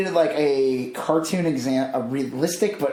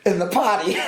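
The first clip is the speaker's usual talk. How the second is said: usual speaking voice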